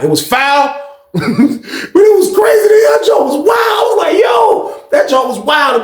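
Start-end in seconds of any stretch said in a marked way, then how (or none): 1.1-5.8 high-pitched laughing disbelief; squealing, astonished reaction; amazed outburst. Real, not acted. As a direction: exclaim it, blown away. Teller reacting to shocking insult.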